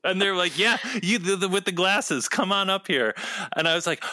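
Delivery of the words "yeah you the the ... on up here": lightly voiced host on stage; stage host calling out. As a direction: call him up like a show host.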